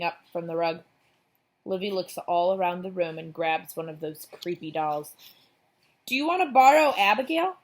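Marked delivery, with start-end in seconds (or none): 6.1-7.6 eager. A narrator's reading. Livy overly eager offering doll.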